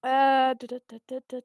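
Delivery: usual speaking voice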